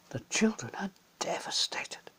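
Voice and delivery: whispered narration of grief; sad whisper. A narrator's reading. Narrator tells of the children's grief, whispered. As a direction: breathe it out in a hush.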